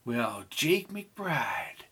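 slow whispered greeting; whispering. Acted. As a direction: breathe his name in a whisper. Woman greeting an old flame.

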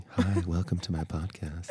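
hushed laughing podcast host voice; lightly put-on podcast host greeting. Shown as a demonstration. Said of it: "This is my podcast voice".

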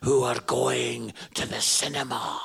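scary preacher. Retold or imitated. Imitating the preacher pointing at the congregation, low and accusing. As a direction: accuse them like a stern old preacher. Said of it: "he would be like".

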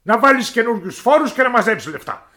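high, loud royal tantrum; a spoiled king shouting his complaint; petulant shouting king; angry king. Acted. King orders new taxes.

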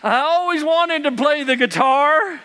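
high, lightly voiced old man, cheerful; grandpa's cheerful quip. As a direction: play it like a grandpa joking from the floor. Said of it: "and he goes".

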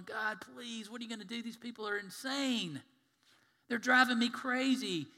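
exasperated plea. Acted. Moses complains to God about his people, acted exasperation. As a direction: play a leader at wit's end.

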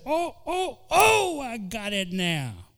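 high sly devil voice, drawn out; Satan gloating. Acted. Satan gloating over a complaining believer, sly high voice. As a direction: gloat like the devil who got in. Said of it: "he says".

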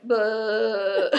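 loud mock "bleh"; blurted blank noise. Imitating herself when asked for themes, loud bleh. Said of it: "and I'm like".

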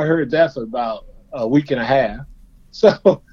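ordinary talk, nothing marked

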